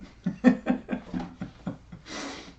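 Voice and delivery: long breathy chuckle trailing away; wheezy laugh at a fond memory. Real, not acted. Echoing his late friend's favorite curse, fond breathy laughter.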